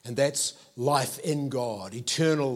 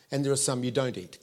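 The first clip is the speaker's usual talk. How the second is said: ordinary talk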